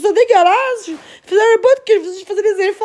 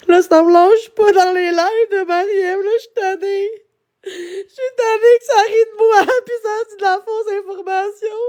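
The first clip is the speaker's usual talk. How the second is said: real crying, worn-out exasperation; tearful and fed up. Real, not acted. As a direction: plead through tears, at the end of your rope. (en pleurs, à bout)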